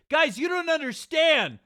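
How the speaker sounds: very high, loud squealing confession; whiny confession voice. Retold or imitated. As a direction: squeal it out while laughing. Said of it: "He's like".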